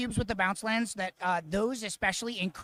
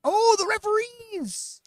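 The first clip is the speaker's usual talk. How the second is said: high, drawn-out, loud exclamation; excited outcry; 13 semitones higher, 5 dB louder, slower than usual. Real, not acted. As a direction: cry out as the referees appear. Commentator greets card with mock excitement.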